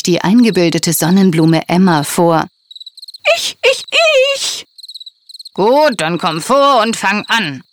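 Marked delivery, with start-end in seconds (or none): 3.4-4.7 very high, eager me-me-me; squealing to be picked first; eager shout. A narrator's reading. Vain flower pushing to the front. 5.5-7.6 lightly voiced mayor inviting her up; a hint of a kindly mayor; mayor's friendly go-ahead. A narrator's reading. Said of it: "sagte der Bürgermeister".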